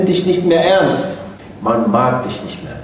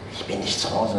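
mock whisper into the phone; geflüstert; 6 semitones lower, 12 dB quieter than usual. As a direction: whisper the little lie, hardly voiced. Kind flüstert Lüge ins Telefon. (geflüsterte Ausrede des Kindes)

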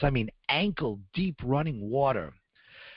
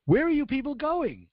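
retold yell; 11 semitones higher than usual. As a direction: shout it down to strangers below. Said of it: "some person yells out".

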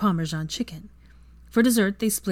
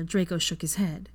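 ordinary delivery

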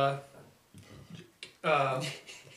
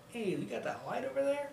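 retold question; 9 semitones higher, 4 dB quieter than usual. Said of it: "he said".

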